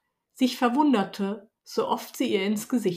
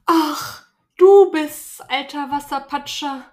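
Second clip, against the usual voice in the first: higher, tearful princess, loud burst at the start; a spoilt princess, startled then sulky; young princess; 5 semitones higher, 5 dB louder than usual. Acted. Princess startled by the frog, higher voice bursting out.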